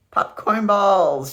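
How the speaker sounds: slow, loud and high; drawn-out happy shout. A narrator's reading. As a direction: shout it with delight. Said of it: "shouted Aunt Mira".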